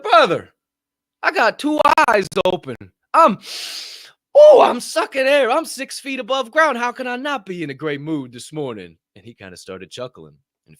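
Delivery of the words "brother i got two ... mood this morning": very high and loud, fading at the end; exuberant. Retold or imitated. Answering the locker room guy, exuberant shout. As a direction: belt it out like a revival.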